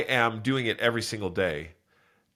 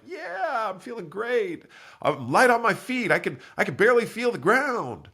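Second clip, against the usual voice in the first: high-pitched mock elation; feeling great; 11 semitones higher than usual. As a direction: act out a runner feeling fantastic, voice way up high. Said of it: "I feel completely one hundred percent like".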